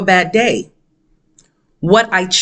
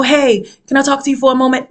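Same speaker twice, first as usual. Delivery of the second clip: higher-pitched, imagined courteous approach; mock polite request; 6 semitones higher than usual. Voicing the polite request she never got.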